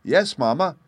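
put-on high, loud, solemn child; grave little boy. A narrator's reading. Little boy backs his sister up to mamma, very earnestly. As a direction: affirm it with small-boy gravity. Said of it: "asseverated Peony, with much gravity".

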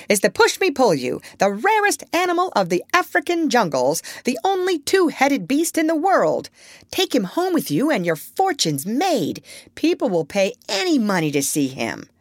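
high-pitched showman's announcement, easing down; proud monkey presenter. A narrator's reading. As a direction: present a rare marvel eagerly as a lightly voiced monkey. Chee-Chee presents the animal proudly.

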